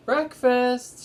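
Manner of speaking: calling out high and loud, cheerful; landlady calling the children to breakfast, loud and high; announcing. A narrator's reading. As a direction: sing out that breakfast is ready. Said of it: "she announced".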